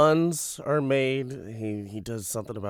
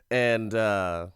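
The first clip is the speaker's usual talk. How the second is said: ordinary delivery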